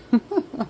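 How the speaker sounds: short chuckle; a brief laugh after your own remark; light, quick laugh; amused laugh. Real, not acted. Amused at how stupid people are.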